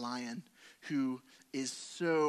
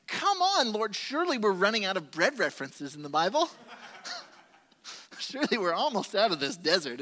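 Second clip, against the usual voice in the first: half-laughing, exasperated complaint, pitched much higher and louder; exasperated protest; 6 semitones higher, 9 dB louder than usual. Retold or imitated. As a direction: throw up your hands at God, mock exasperation. Exasperated plea to God retold.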